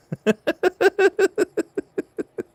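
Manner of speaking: long high-pitched giggle; giggling. Real, not acted. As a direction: giggle on in a quick string. Amused by own restaurant joke.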